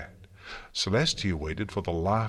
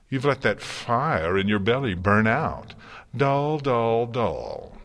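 louder, higher theatrical disappointment; taunting Discord; 5 semitones higher, 5 dB louder than usual. Acted. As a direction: needle her like a bored showman.

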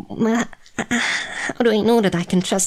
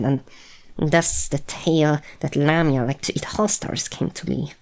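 ordinary delivery

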